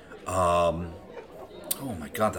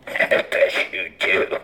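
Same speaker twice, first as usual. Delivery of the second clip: teasing aside; 7 semitones lower, slower than usual. Acted.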